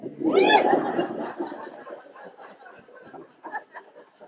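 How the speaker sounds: a breathy burst of laughter at Dex's answer; amused laugh. Acted. Narrator laughing at Dex's dodge, breathy chuckle. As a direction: laugh it off, airy and unvoiced.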